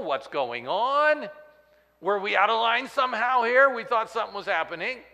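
high, loud puzzled questions; bewildered crowd. Acted. The crowd wondering why Jesus weeps, high loud bewildered questions. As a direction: sound baffled and a bit hurt.

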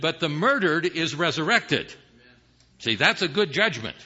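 normal talking voice

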